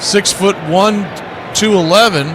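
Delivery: play-by-play. Acted. Calling the game play-by-play.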